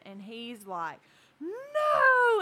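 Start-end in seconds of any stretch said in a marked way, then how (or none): 1.4-2.4 high, loud imitated refusal; mock panicked cry. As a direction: yell it like a panicking kid. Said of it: "he starts panicking all over again".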